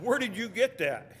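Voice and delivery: high, emphatic imitated query; retold insistent question. Retold friend repeats puzzled question.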